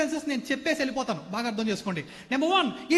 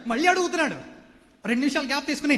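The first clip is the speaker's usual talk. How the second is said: ordinary talk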